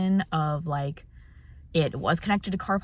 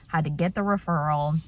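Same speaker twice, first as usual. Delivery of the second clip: ordinary delivery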